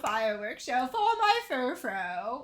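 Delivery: pompous rich man, gushing; hammy snobbish aristocrat; pretentious voice. Acted. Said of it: "I do a mean pretentious".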